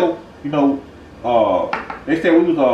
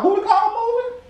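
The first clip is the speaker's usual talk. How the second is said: much higher, outraged disbelief; shocked question; 14 semitones higher than usual. Acted. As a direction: squeal it like your favorite thing is gone. Shocked favorite movie stolen.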